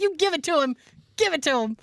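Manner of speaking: playfully egging the lawyer on; egging on, laughing. Retold or imitated. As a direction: cheer him on with a laugh.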